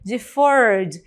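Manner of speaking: "Deferred" ending straight on D; teacher's demo of "Deferred" with the E dropped; silent E. Shown as a demonstration. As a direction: say "Deferred" with no extra syllable for -ed. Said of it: "também eu não pronuncio a letra E".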